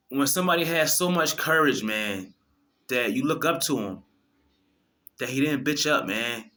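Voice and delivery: normal talking voice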